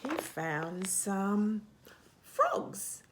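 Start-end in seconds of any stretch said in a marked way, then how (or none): 2.2-3.0 sudden very high breathy reveal; surprise reveal. Acted. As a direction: pop the word out high. Dramatic page-turn reveal.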